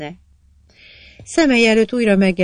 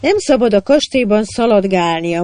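louder, urgent and fervent; passionately; 5 semitones higher, 8 dB louder than usual. A narrator's reading. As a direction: forbid it with passion. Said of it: "mondta a királyné szenvedélyesen".

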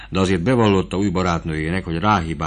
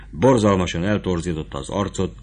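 ordinary delivery

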